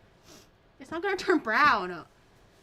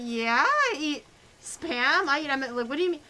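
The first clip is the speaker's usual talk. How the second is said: defiant; 3 semitones higher, 6 dB louder than usual. Retold or imitated. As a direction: stand your ground, a bit puzzled. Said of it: "And I was like".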